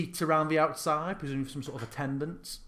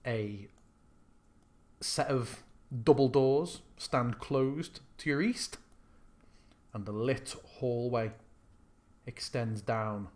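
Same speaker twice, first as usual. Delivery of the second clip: scene narration; 4 dB quieter than usual. Acted. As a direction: set the scene like a game master. GM describing the castle.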